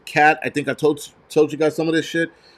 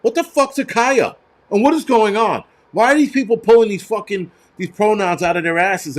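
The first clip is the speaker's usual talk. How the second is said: high, incredulous rant; exasperated disbelief; 6 semitones higher than usual. Real, not acted. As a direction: demand an answer, totally baffled. Ranting in disbelief at the name.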